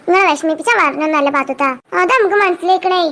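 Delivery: ordinary talk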